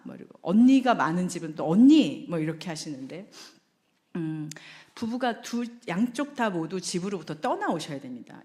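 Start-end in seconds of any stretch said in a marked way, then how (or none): 1.7-2.3 loud call across the house; calling big sister. Retold or imitated. Younger sibling calling big sister. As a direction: call out for your older sister.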